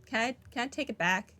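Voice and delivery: ordinary talk, nothing marked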